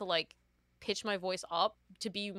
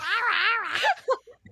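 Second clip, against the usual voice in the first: high shrieking laugh; 13 semitones higher, 6 dB louder than usual. Real, not acted. Laughing after quote.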